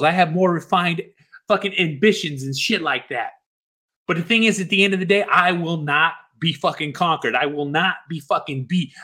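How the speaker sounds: ordinary talk, nothing marked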